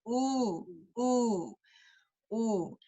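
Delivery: "ooh" held long and soft with rounded lips; pure, rounded "ooh", repeated slowly; rounded Spanish U. Shown as a demonstration. Teacher showing parents the Spanish U, rounded lips. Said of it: "your lips should be rounded".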